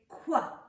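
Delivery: "Qua" loud and unvoiced; whispered qua sound. Shown as a demonstration. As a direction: say "Qua" sharp with no voice. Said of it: "put on our crowns and say".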